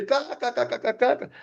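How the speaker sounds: high imitated cackling laugh; friend's cackle. Friend cackling at the blue tooth, high mock laugh. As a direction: mimic a friend who can't stop laughing.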